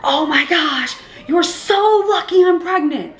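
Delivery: high, drawn-out outraged cry; mock yell, stretching the words; retold angry yell. Pregnant mother warning the other mom, retold angry yell. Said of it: "I yelled so loud".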